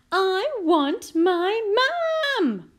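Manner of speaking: put-on loud, high, drawn-out child's wail; crying out. A narrator's reading. Princess with a banged knee wanting mum, crying out. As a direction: wail it slowly as a little princess. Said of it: "cried the little princess".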